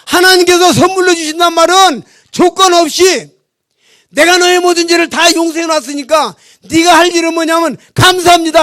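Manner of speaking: loud, high preaching at full force; loud, high preaching over the congregation; forceful preaching. Acted. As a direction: proclaim it to the congregation.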